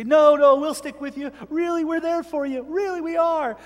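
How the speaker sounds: high-pitched mock protest; mock pleading. Daughters-in-law insisting they'll stay, mock pleading.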